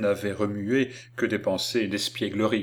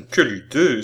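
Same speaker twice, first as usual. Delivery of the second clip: loud, scoffing exclamation; exclaiming; 3 semitones higher, 7 dB louder than usual. A narrator's reading. Man dismissing the girl's idea of waking him, exclaiming. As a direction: cry out at an absurd idea. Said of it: "s'écria monsieur Lecoq".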